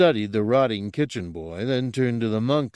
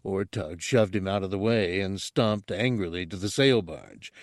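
usual speaking voice

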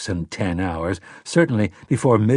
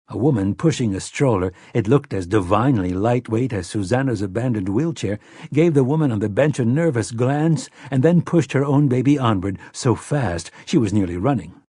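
plain reading voice

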